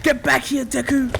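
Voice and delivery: high, loud bully call; yelling after him. Acted. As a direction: shout after the boy to stop. Bully chasing boy, calling out.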